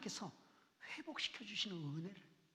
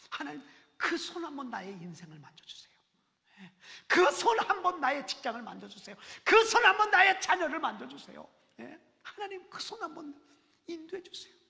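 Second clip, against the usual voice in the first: rising to loud, high pleading; building fervent plea; 4 semitones higher, 13 dB louder than usual. Acted. Preacher voicing a prayer for God's touch, building loud. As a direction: swell into a passionate plea.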